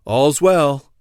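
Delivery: a moderate narrated cry; sentry's cry. A narrator's reading. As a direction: call it out from your post. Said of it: "step back and cry again".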